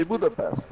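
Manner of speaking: "Budapest" with a plain s near the end; English pronunciation. Retold or imitated. Complaining how films say it, English pronunciation. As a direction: say "Budapest" the anglicized way, no sh. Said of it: "they say Budapest".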